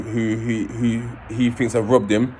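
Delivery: ordinary talk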